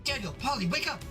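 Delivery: high, urgent alarm; urgent wake-up call. Acted. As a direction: call the children awake fast. Matron wakes children.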